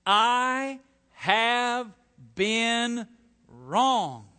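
loud, drawn-out "I", each word punched separately; stress on I. Shown as a demonstration. Preacher voicing unrighteous anger, emphatic stress on "I". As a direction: pound out every word, starting with a big "I". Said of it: "emphasis on the word I".

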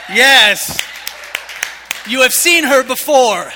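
loud, high, slow brag; booming proud aside; proud shout. Real, not acted. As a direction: show her off to the crowd. Boasting about his wife.

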